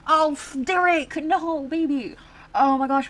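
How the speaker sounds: shocked outcry. Real, not acted. Shocked at sim's romance choice.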